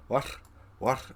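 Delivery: "What?" cut short with a catch; catch on words. Shown as a demonstration. Friend demonstrating Preston's former speech, "What?" with a catch. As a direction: say "What?" with that Irish-sounding catch. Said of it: "That sort of catch".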